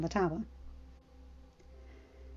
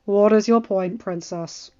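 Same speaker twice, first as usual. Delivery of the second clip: cold, detached; 6 dB louder than usual. Acted. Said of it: "cold and detached".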